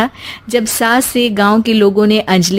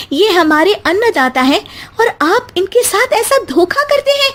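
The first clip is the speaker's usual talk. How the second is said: high, accusing challenge; wife confronting clerks at the office; angry confrontation; 6 semitones higher than usual. Acted. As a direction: call out the officials to their faces.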